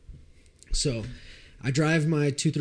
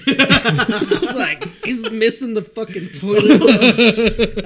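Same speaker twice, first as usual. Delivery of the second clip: laughing; 10 semitones higher, 13 dB louder, much slower than usual. Real, not acted. Laughing through the toilet story.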